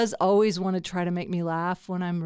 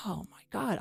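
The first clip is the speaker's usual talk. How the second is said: hushed, whispered realisation; whispered dismay; 9 dB quieter than usual. Acted. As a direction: whisper it as if it just dawned on you. Voicing sudden worry about earning.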